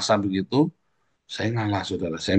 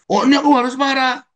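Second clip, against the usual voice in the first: much higher, loud self-justifying voice; mock hothead excuse; 5 dB louder than usual. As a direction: play the hothead finding a reason. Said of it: "alasan kita yang pemarah".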